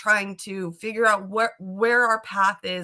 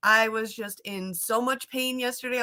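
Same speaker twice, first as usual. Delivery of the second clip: ordinary delivery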